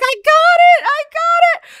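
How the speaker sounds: high thrilled shout; excited squeal. Retold or imitated. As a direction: shriek with joy at the news. Calling boyfriend about role.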